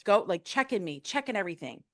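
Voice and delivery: normal talking voice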